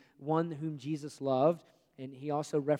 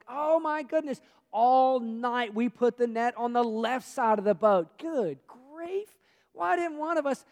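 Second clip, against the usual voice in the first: mock exasperation; 7 semitones higher, 5 dB louder, slower than usual. Fishermen exasperated, imagined.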